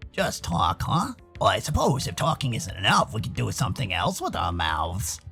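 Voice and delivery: low, flirtatious character voice; deep, soft, playful innuendo; deep-voiced teasing friend. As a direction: tease suggestively, dropping very low.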